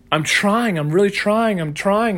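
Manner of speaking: high-pitched mock pleading; insistent protest. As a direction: imitate someone insisting on their effort. Acts out the brain insisting.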